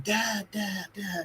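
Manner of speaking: son in a memory. Retold or imitated. Remembered son calls his father, soft imitated child. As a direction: imitate a small, distant call. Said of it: "we see the memory of his son going".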